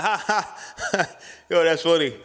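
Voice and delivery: high, loud put-on laughter; mock an awkward, people-pleasing laugh; uncomfortable laugh-off. Laughing off a joke when uncomfortable, imitated forced laugh. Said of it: "when I'm uncomfortable, I laugh it off".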